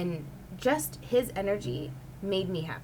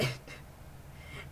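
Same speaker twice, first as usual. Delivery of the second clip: airy laugh; 11 dB quieter than usual. Real, not acted. Laughing at own plan.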